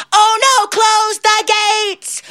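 very high wail, drawn out; alarmed little devil. Acted. Little devil spots John coming to hell, alarmed. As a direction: shriek the alarm, stretching the last words.

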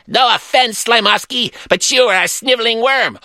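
high-pitched theatrical insult; hammy villain. A narrator's reading. Villain answering his rival's insult, high and haughty. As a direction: declaim it as a rival stage villain.

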